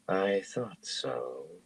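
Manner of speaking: very slow, soft, sweet drawl; slow honey drawl. Acted. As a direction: stretch every word like honey. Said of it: "in that slow, honey drawl".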